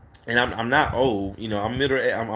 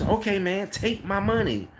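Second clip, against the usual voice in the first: higher, excited, can't wait to pay; eager customer; 8 semitones higher than usual. Retold or imitated. A cleaner jumping at the consulting price, excited and higher. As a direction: toss the money at him.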